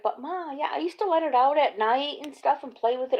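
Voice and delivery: put-on high whiny kid protest; son's protest. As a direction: plead like a sulky boy. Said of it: "he's like".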